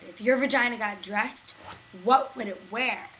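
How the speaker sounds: loud, projected flash-mob opener; calling out. Retold or imitated. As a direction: call it out loudly to a crowd. Opening line called out in flash mob.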